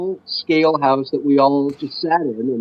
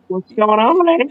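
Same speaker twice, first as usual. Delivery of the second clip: mock Southern Canadian; 5 semitones higher than usual. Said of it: "it's the Southern Canadian".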